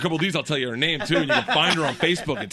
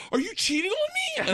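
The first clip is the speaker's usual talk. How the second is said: much higher mock girlfriend voice. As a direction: squeak it like the accusing girlfriend. Girlfriend quoted, high voice.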